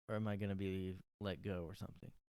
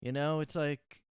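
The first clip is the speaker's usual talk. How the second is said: ordinary delivery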